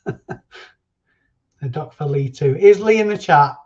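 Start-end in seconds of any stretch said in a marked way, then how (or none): 2.6-3.6 loud, raised-pitch call; shouted question to the crowd. Real, not acted. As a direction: call it out loudly to find someone. Calling out for a viewer.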